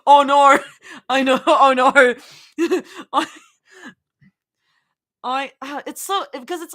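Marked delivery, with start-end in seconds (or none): 0.1-0.6 loud, exaggerated Australian vowels; Australian accent. Shown as a demonstration. Streamer practising Australian with a stock phrase, exaggerated accent. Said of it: "Just say, "Oh no," a bunch of times". 1.5-1.9 loud, exaggerated Australian vowels; Australian accent. Shown as a demonstration. Streamer practising Australian with a stock phrase, exaggerated accent. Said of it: "Just say, "Oh no," a bunch of times".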